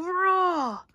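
loud high roared word; roaring word. A narrator's reading. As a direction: roar it out like a beast. Narrator imitates bear's roar.

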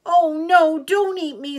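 high, loud alarmed squeal; frightened plea. A narrator's reading. Little Gruff begs the troll, high frightened plea. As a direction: plead in a high little-goat voice.

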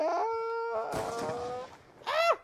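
silly high-pitched squeals; high mock squeals. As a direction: squeal like a cartoon girl. Mock squeals after meme.

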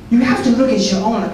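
ordinary talk

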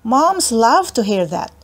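usual speaking voice

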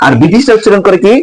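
usual speaking voice

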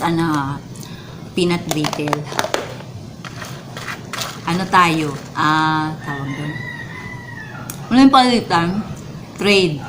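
normal talking voice

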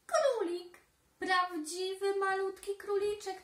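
high, loud gasp of a word; suddenly amazed; surprised discovery. A narrator's reading. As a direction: exclaim it in amazement.

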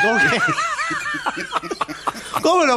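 laughing out the question, pitch jumping up; cracking up in mock outrage at the bread; laughing disbelief. Real, not acted. Etiquette host, laughing at the guest's answer.

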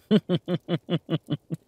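high, loud rattling laugh; hearty laugh. Acted. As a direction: crack up at your own joke. Laughing at own joke.

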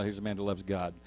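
ordinary talk

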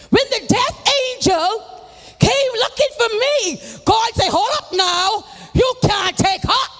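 full-force preaching. Acted. Preacher testifying to the congregation, full-force preaching. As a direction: preach it out to the saints.

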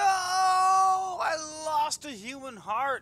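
loud, slow, crying out in dismay; anguished shout. Real, not acted. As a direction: wail over the lost heart. Lost item in game, cries out.

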